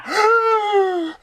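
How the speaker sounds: sharp high imitated gasp; shocked gasp. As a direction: suck all the air out in a mock gasp. Retelling Adam's shocked reaction.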